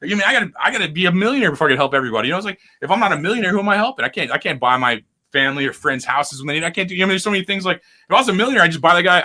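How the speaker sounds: ordinary talk, nothing marked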